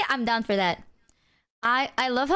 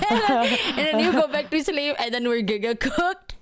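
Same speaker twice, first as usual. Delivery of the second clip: laughing while talking; 5 semitones higher than usual. Real, not acted. Laughing at own story.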